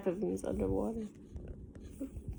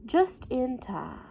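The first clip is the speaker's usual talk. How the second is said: ordinary delivery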